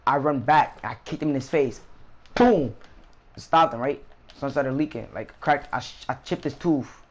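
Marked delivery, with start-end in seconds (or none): 2.4-2.7 high, loud boom falling away; explosive retold kick; kick sound effect. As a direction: burst it out like the blow landing. Imitates the kick landing.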